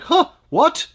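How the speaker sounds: high, loud mock startle; startled awake. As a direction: play a listener jolted to attention. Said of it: "You wake them up".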